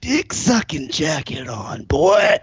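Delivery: slow, drawn-out mock taunt. Friend re-enacts the jacket heckle, mock taunt. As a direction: stretch the jibe, punch the last word.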